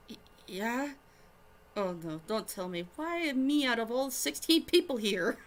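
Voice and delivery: nervous, stammering reply; flustered and embarrassed, stumbling. Acted. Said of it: "Shukin queered, still flustered".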